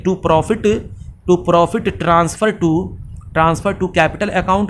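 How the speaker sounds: very slow, word by word, for note taking; slow dictation. Shown as a demonstration. Teacher dictating the entry to students, very slow and drawn out. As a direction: spell it out slowly so the class can write it down.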